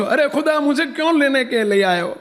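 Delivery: high, insistent question; calling to God. Retold or imitated. Old woman asking Khuda why he came, calling out. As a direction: put the question upward, pitched high.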